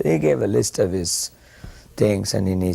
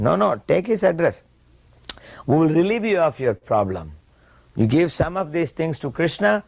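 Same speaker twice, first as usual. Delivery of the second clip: higher, emphatic reassurance; firm insistence; 9 semitones higher than usual. Retold or imitated. Prabhupada to the businessman, overruling him, raised and emphatic. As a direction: overrule him kindly but firmly.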